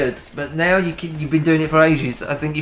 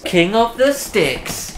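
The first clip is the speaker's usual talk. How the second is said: drawn out, higher announcer call; 6 semitones higher, much slower than usual. Acted. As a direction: boom it like a game show host. Dramatic announcer repeat.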